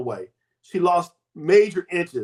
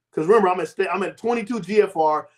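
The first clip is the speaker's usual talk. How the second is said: usual speaking voice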